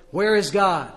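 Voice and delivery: loud stern booming demand. A narrator's reading. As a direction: bellow the question at the frightened boy. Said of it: "raised his voice another octave".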